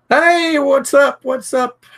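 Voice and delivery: high, loud hey to someone joining; excited greeting. Real, not acted. Calling out greeting to viewer.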